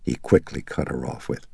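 ordinary delivery

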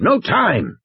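high, emphatic rejection; impatient, insistent game warden; emphatic dismissal. Acted. Muldoon repeats his objection.

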